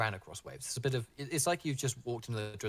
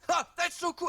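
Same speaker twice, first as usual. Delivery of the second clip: high, thrilled surfer-dude cry; stoked surfer; 5 dB louder, faster than usual. Acted. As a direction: squeal with delight. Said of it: "And then he goes".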